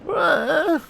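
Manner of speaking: high-pitched synthetic robot whimper; robot crying. Acted. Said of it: "See, now he's crying".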